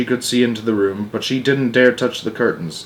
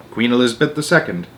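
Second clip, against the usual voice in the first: plain reading voice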